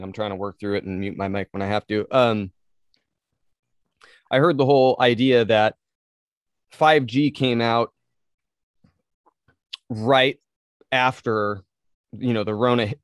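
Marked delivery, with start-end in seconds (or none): 2.2-12.9 hiccups breaking up the words; pausing to fight hiccups. Real, not acted. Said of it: "Sorry, I'm hiccuping".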